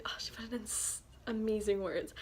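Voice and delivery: usual speaking voice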